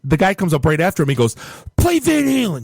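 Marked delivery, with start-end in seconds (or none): 1.8-2.6 high-pitched mock yell of an angry request; imitated yell. Bar customer demanding a song from the DJ, imitated yell. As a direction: imitate a heckler yelling up high, no real volume. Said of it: "yells at me".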